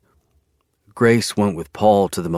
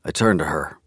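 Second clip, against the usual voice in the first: plain reading voice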